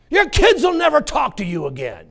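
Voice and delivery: high, loud devil threat; devil's voice. Acted. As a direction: taunt like the devil.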